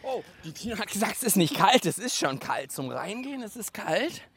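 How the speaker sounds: normal talking voice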